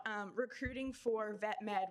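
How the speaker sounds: ordinary talk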